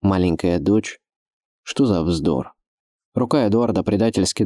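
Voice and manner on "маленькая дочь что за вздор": incredulous echo in a man's voice; understated male disbelief; sceptical captor. A narrator's reading. Edward's sceptical echo of her answer.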